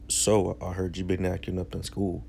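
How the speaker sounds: a low, soft, ominous mom voice, the calm before the storm; quietly threatening mom, acted out lightly; quietly menacing mom. Mom confronting son slowly.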